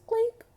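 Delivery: high loud mouth sound effect; imitated clink. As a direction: squeak out a clink noise.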